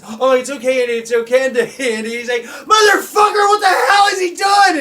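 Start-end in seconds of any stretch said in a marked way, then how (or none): 0.0-1.6 loud, high, fussing reassurance; mock comforting dad. Dad rushes to comfort screaming brother. 2.4-4.8 high, very loud outraged yell; cussing and screaming. Retold or imitated. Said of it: "just cussing and screaming".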